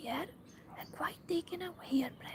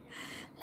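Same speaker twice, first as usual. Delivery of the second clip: whispered giggle; 12 dB quieter than usual. Real, not acted. Laughing mid-sentence.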